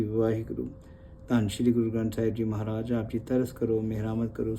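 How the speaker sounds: softly recited opening prayer; prayer recitation. Real, not acted. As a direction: recite the invocation with reverence. (ਅਰਦਾਸ ਵਾਂਗ ਨਰਮ ਪਾਠ)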